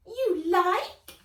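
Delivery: very high, loud elf voice; teasing elf. Acted. Elf tempting Nat with the box, high and sly. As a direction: tempt her with the treasure. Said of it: "said the elf".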